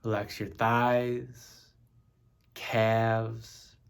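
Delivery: slow, measured meditation voice with long pauses; name each part unhurriedly, leaving space to relax; guided body scan. Acted. Meditation leader guiding listeners through the body, slow and spaced.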